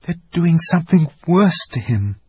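put-on a girl fearing the worst; anxious. A narrator's reading. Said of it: "said Lucy".

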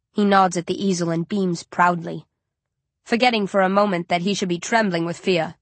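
usual speaking voice

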